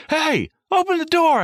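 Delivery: high, loud panicky call through the door; imitating a scared guy begging at the door; mock panicked Seth. Seth calling out in a high panicked voice.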